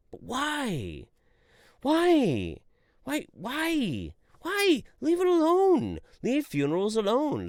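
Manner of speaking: high, loud exasperated pleading; exasperated why. Real, not acted. Pleading with funeral speakers, high exasperated cries. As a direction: beg them to stop.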